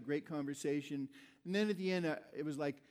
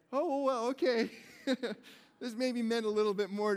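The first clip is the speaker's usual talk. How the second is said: surprised realization; 5 semitones higher, 6 dB louder than usual. Retold or imitated. Realizing it meant more.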